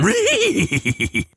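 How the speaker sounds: high, breathy cartoon dog snicker; Scooby-Doo laugh. The host laughs at a name like Scooby-Doo, in a high cartoon snicker. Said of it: "doing a Scooby-Doo laugh".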